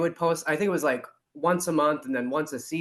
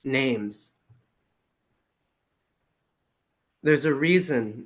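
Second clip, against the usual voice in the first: poetry reading. Acted. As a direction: read the poem line by line, letting each phrase settle. Reads their poem aloud.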